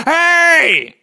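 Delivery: long, high battlefield yell. Acted. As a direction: holler it out to stop a whole fight. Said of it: "he yells, he goes".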